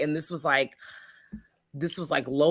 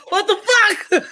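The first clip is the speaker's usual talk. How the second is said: high, loud, laughing through the words; cackling in disbelief at the twist; 7 dB louder, slower than usual. Retold or imitated. Podcaster retelling her reaction, laughing loud and high. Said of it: "'Cause I'm like".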